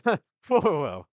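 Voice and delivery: high, loud amused whoa; loud mocking whoa. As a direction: scoff loudly at the challenge. NPC laughs off the fight.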